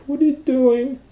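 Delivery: very high, loud squeal; panicked little boy; alarmed child. Acted. Owen alarmed.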